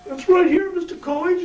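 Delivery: very high, earnest congratulating voice; Carl's voice. Retold or imitated. Carl congratulating Bad News on his son, very high. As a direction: pipe it up high and sincere.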